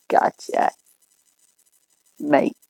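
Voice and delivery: badly faked Aussie accent; bad Australian accent. Vlogger playing at being an Aussie for viewers, bad Australian accent. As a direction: try a clumsy Australian accent. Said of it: "Sorry for the bad accent".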